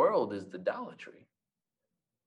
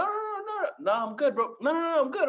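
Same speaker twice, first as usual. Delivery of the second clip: mock refusal; faster than usual.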